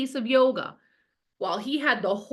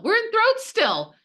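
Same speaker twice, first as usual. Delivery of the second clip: much higher, louder playful echo of throat; squeaky mock repeat; 12 semitones higher, 5 dB louder than usual. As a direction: say throat way up high, teasing. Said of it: "this is like".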